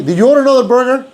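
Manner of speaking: high, fast wife voice; wife's question. Retold or imitated. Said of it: "Patty goes like".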